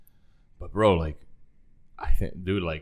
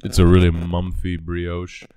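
mouth full; 3 semitones lower, 7 dB louder, slower than usual. Real, not acted. Chewing a bite while talking.

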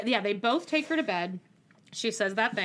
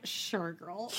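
sarcastic low; 6 semitones lower, 11 dB quieter than usual. Retold or imitated. Sarcastic aside.

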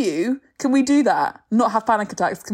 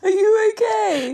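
concerned; 9 semitones higher, slower than usual. Real, not acted. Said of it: "This is really sad".

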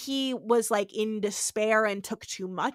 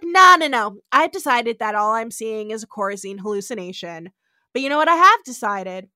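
loud declaration; 9 dB louder, faster than usual. Retold or imitated. McCoy's loud declaration to Edith.

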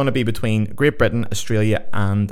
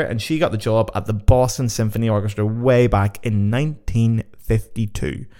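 ordinary delivery

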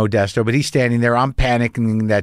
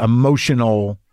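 ordinary delivery

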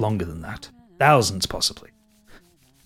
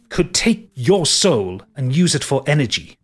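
ordinary talk

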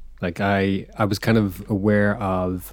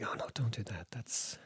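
hushed warning; 7 dB quieter than usual. Retold or imitated. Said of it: "it's like".

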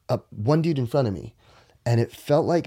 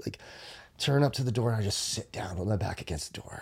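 normal talking voice

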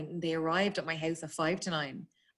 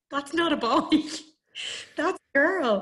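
laughing; 10 semitones higher, 8 dB louder than usual. Real, not acted. Retelling the fireman, laughing.

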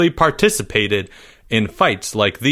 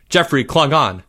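ordinary delivery, nothing marked

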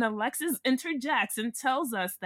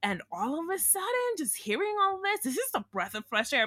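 imitating Stasha; 8 semitones higher than usual. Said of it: "she's like".

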